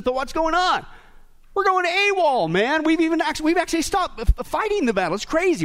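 high-pitched, incredulous outcry; exasperated preaching. Real, not acted. Preacher decries the church going awol, exasperated. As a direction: cry out high at the absurdity.

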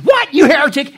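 high, loud indignant shout; explosive mock-scandalized yell; acted outrage. Punchline, guy turns on the other.

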